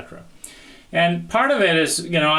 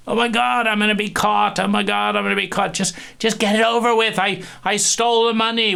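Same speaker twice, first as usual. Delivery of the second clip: much higher, quick panicked babble; guilty panic; 7 semitones higher, faster than usual. Retold or imitated. Guilty thief dreading being caught, hammed-up panic. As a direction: blurt it like a thief about to be caught.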